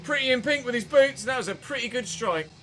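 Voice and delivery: ordinary talk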